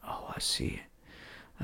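slow breathy whisper. Retold or imitated. Friend in the hunting blind answering, whispering. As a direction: whisper it slowly, not to spook the deer. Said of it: "Bro, stop whispering".

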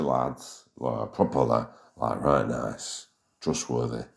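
low, slow, soft Yorkshire lad; Yorkshire accent. Retold or imitated. Boasting about Yorkshire lads in a low Yorkshire voice. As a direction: drop into a broad Yorkshire drawl.

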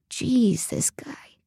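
unvoiced, disbelieving mutter; hushed private grumble; whispered aside. A narrator's reading. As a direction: whisper it to yourself, dropping away. Narrator's muttered inner aside.

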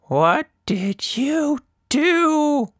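put-on very slow, high, stunned old woman's voice; shocked Anita. A narrator's reading. Anita staring at Tilda over her broken phone, slow and high. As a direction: draw out each word in disbelief. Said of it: "looked at Tilda".

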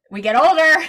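a real laugh breaking into the line. Real, not acted. Guest laughs about getting older, laughing. Laughing at her own age.

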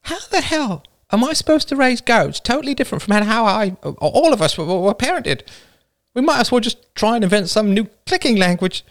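high, strained rant; fuming, pitch pushed right up; exasperated outburst. Acted. Villager protests about raising goats, exasperated.

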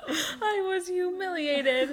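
high, slow, laughing whine; drawn-out fake sob of embarrassment; mock humiliated wail. Mock lament amid laughter.

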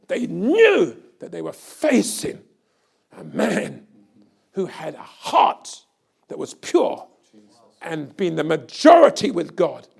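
loud, soaring preacher's cry; fiery revival preaching; preaching at full force. Acted. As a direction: preach it to the back row, building hard.

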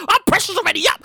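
ordinary talk

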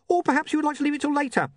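very high, rushed imp; hurried, squeaky climb-down; desperate imp. Acted. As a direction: back off fast in a high little voice. Imp backing off after knife attack.

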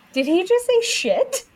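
high, incredulous question; stunned, she can't believe her ears; disbelieving mom asking her husband about the toddler's word; shocked disbelief. Retold or imitated. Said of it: "I said".

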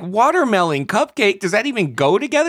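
high, loud baffled question; can't believe these go together; incredulous. Retold or imitated. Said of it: "I'm like".

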